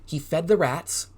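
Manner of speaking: plain reading voice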